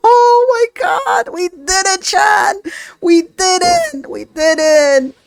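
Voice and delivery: breathless happy disbelief; high, loud giddy joy; giddy relief. Real, not acted. Celebrating the win.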